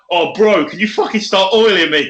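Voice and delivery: a chummy lad calling out; mate voice. Retold or imitated. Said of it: "and he's like".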